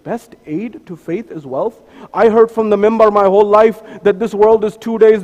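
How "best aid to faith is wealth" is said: Best aid to faith is wealth? softer and slow, rising on wealth in disbelief; doubtful question. Pretend. Imagined listener doubting the preacher, quietly incredulous. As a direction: voice the listener's skeptical objection quietly. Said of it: "You may say".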